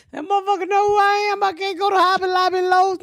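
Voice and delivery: high, laughing through the words; cracking up while playing the fan; laughing mock fan. Imagining a fan who spots her, laughing high.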